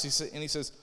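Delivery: usual speaking voice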